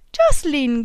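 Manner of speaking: name called out high and loud with joy; young woman delighted to hear Jocelyn on the phone, calling his name out high and loud; joyful cry. A narrator's reading. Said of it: "gridò lei con gioia".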